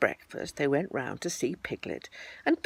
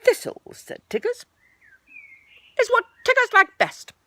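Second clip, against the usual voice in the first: much higher, louder Tigger boast; 7 dB louder than usual. Acted. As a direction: declare his new favourite. Tigger claims thistles.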